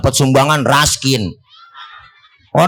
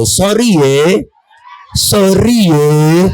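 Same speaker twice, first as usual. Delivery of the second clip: mock apology; 6 semitones higher, 3 dB louder, much slower than usual. Mocking a politician's apology.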